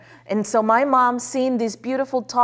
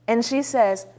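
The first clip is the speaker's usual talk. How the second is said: ordinary talk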